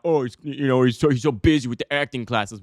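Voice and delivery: lightly mocking imitation of a critic. Mocks what others say of him.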